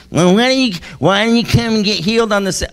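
lampoon a fussy legalist; mocking petty religious man. Pastor mocking the synagogue ruler in a whiny voice. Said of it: "penny ante, religious-minded ridiculousness".

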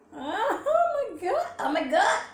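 high, loud food squeal; excited. Real, not acted. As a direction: gasp with delight at the icing. Reacting to dripping icing.

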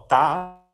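loud, long-held "tá"; syllable demonstration. Shown as a demonstration. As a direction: sustain "tá" firmly, like a note. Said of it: "Eu fiz, ó". ("tá" forte e sustentado)

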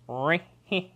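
hammed-up drawn-out catchphrase; Jim Carrey. Host quoting a comedian to co-hosts, hammed-up. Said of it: "As Jim Carrey would say".

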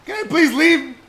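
high panicked plea; scared horror game streamer. Real, not acted. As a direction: beg to get out.

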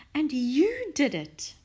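high, loud, slow exclamation; grateful cry. A narrator's reading. Missus Silver crediting Mister Hoppy, crying out delightedly. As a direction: give him all the credit, delighted. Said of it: "Missus Silver cried".

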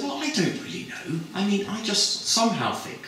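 high, hesitant and chatty; a lightly voiced girl fumbling for reasons; dreamy high sales pitch; gushing student. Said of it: "And her friend said".

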